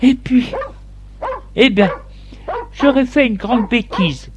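storyteller's high voice for the wife; wife's reply. A narrator's reading. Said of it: "Elle lui dit".

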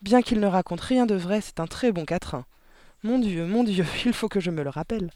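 pleased, lifted and emphatic; delighted. A narrator's reading. Miss Candy praises the quatrain about herself, delighted. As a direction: praise it with happy enthusiasm. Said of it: "dit-elle ravie".

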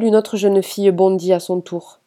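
usual speaking voice

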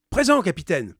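high, loud answer to the captain; calling back. A narrator's reading. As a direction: shout back at a roll call. Answers the captain's roll call.

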